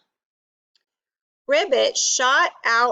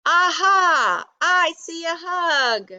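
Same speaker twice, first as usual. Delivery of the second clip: pitched-up Robot delight; delighted exclamation; 7 semitones higher than usual. A narrator's reading. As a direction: burst out on a sudden discovery. Robot suddenly wakes up.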